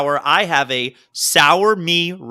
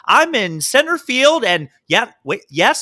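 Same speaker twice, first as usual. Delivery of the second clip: higher, louder reporter voice; field reporter voice; 4 semitones higher, 4 dB louder than usual. Retold or imitated. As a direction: mimic a stadium field reporter. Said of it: "she has a microphone".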